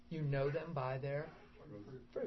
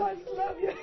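much higher, giddy and delighted; high-pitched joyful gushing; 5 dB louder than usual. Retold or imitated. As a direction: bubble over with love like a happy child. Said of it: "I was just doing like this".

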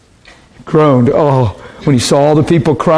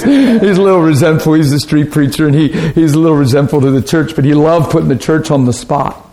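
normal talking voice

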